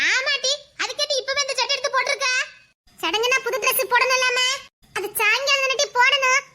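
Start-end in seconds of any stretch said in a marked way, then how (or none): none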